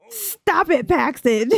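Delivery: high-pitched mock scolding between laughs; playful scolding. Player telling off an annoying game character, playful high-pitched scolding.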